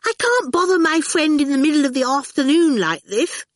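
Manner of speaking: high-pitched fussy objection; crying out. A narrator's reading. Miss Potter unwilling to bother her friend, protesting. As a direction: object in a high, put-upon voice. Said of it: "Miss Potter cried".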